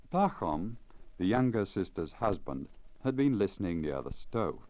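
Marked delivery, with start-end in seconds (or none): none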